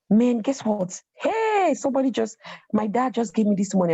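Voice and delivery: high, eager rush of news; excited news. Retold or imitated. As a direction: burst in with good news. Excitedly sharing news with him.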